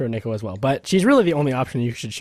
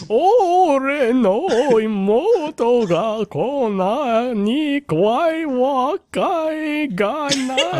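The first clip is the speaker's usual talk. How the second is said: very slow, high, quavering great-grandpa; hammed-up wavering old man, pitched up; exaggerated vibrato; 8 semitones higher, much slower than usual. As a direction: wobble every long-drawn syllable. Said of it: "It had a lot of vibrato".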